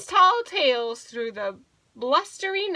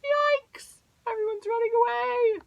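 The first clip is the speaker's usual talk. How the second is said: high-pitched playful alarm; storybook fright, pitched way up; mock panic; 11 semitones higher than usual. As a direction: squeal it like a scared little animal. Animals flee the angry bear.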